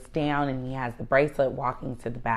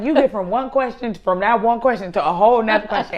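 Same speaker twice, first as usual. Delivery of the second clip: higher, louder mock complaint; exasperated Ian; 7 semitones higher, 9 dB louder than usual. Mother imitating Ian's complaint, higher and louder. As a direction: mimic him complaining. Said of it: "he'd be like".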